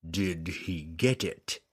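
a mild demon roar. Acted. Demon lord demands news from his servant, low slow menace. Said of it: "Ertu roared".

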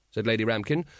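usual speaking voice

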